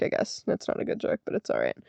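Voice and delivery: soft unvoiced aside to herself; whispered aside. Real, not acted. Admitting to viewers the joke is bad, whispered.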